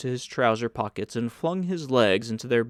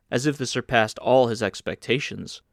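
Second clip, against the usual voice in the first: plain reading voice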